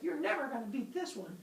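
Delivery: high, tiny gnat voice; taunting little gnat. Acted. She taunts Zeus as a gnat, high tiny voice. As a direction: squeak a smug challenge.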